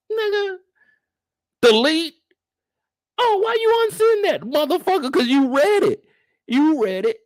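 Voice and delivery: high and loud, almost crying out; scolding a woman who unsent her message; outraged yelling. Real, not acted. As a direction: shout it at whoever left you on read. Ranting at someone who unsent.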